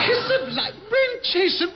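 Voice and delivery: high, shocked splutter of indignation; appalled at the very idea; outraged disbelief. Acted. Captain outraged at suggestion.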